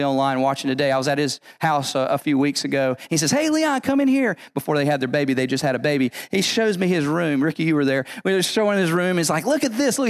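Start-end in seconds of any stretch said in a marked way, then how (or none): 3.3-4.4 high, bright excited call from the next room. Retold or imitated. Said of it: "he's so excited". 9.4-10.1 high, eager showing-off; excited. Retold or imitated. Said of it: "he's so excited".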